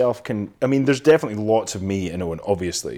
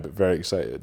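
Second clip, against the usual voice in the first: ordinary delivery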